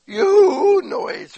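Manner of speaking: high, loud imitated yoo whoop; high imitated woo whoop; mock woo cheer. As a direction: hoot it out like an excited worshipper. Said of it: "and wooing like".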